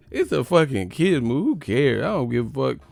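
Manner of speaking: usual speaking voice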